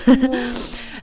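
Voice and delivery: a high, embarrassed laugh through tears; tearful laugh. Real, not acted. Apologising to the host while tearing up, an embarrassed laugh. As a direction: laugh off being choked up. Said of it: "förlåt, jag blir helt så här".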